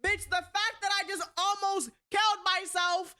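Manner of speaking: high-pitched outraged retort; angry disbelief. Retold or imitated. Daughter retorting to mother's attention accusation, outraged disbelief. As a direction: build indignantly to a loud, high incredulous "what". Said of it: "it made me so angry".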